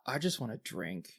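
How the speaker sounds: soft, sighing admission; weary sigh. Acted. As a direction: sigh it out, very softly. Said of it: "She kind of sighs".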